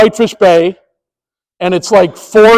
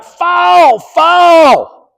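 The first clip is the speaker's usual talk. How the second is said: emphatic call; 10 semitones higher than usual. Retold or imitated. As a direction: bellow the call with full authority.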